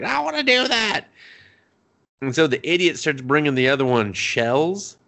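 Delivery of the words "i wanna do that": put-on much higher, whiny childlike plea; whiny insistent girl. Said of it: "She's just like".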